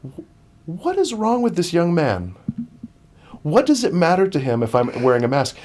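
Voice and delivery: retold disbelief, pressing the questions; baffled indignation. Runner wondering about the yelling stranger, retold baffled indignation.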